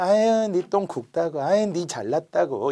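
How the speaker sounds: slow, drawn-out mock flattery; feigned placating. Servant humouring an angry nobleman only on the surface, mock placating. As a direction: humour him with stretched-out, insincere praise. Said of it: "겉으로만".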